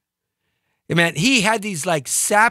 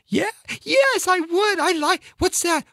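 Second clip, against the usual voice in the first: put-on much higher, eager girl's voice; girly reply; 12 semitones higher than usual. The girl at the bar answers the smooth guy, high and eager. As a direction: answer like a thrilled young woman.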